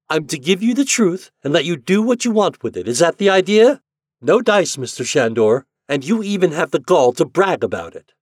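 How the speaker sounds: loud, high, contemptuous woman; scornful young woman. A narrator's reading. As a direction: cut him down with scorn. Anne attacks him scornfully.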